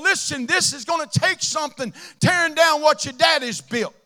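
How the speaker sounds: loud, rising preaching. Acted. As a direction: shout it to the congregation. Preaching at full force.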